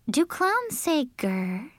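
put-on high, slow girl's question; puzzled Cinderella. A narrator's reading.

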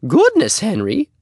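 very high, loud exclamation; Missus Plummer. Acted. Neighbor cuts off the boy's sales talk, high and exclaiming. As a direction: burst out in a high lady voice.

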